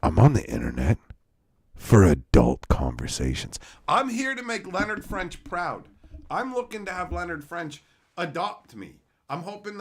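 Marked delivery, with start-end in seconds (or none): 0.0-3.6 much lower, loud pompous boom; mock serious voice. Explaining to chat, deep loud pompous voice. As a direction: boom it like a self-important adult. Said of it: "let me explain something here".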